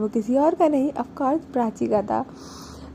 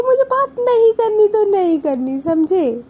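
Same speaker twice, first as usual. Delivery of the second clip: higher, louder mock-angry snap; 8 semitones higher, 6 dB louder than usual. Girl refusing to talk to partner, mock angry. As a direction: voice the angry message like a sulking huff. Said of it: "गुस्से वाला emoji भेज देती है".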